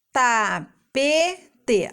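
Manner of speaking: "Tapete" split as ta... pe... te; three stretched beats in "Tapete"; syllable by syllable. Shown as a demonstration. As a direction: piece "Tapete" together syllable by syllable. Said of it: "vamos juntar e formar a palavra".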